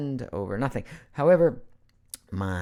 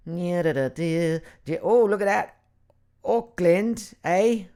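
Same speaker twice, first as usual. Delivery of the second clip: higher, put-on New Zealand accent; badly faked Kiwi accent; 4 semitones higher, 4 dB louder, much slower than usual. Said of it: "that's not a New Zealand accent".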